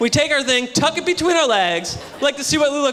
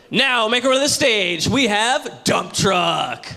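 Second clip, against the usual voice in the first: strip club DJ; 2 semitones lower, much slower than usual. Acted. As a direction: announce the dancer with a long, drawn-out reveal. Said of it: "you hear the D J get on the mic and go".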